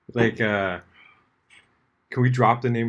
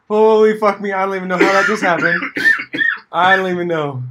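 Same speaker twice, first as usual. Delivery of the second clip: mock astonishment; 9 semitones higher, 10 dB louder than usual. Acting out his baffled reaction.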